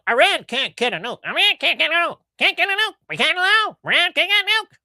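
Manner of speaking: high, excited talk-radio host; Mark Levin impression. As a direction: ham up a ranting radio host pitched high. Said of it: "Well, Mark Levin".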